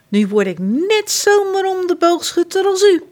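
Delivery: put-on high, excited child's voice; thrilled boy. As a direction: gush with delight over the bow. Boy thrilled by his gift.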